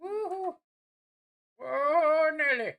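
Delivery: high excited whoop. Real, not acted. Celebrating a big win.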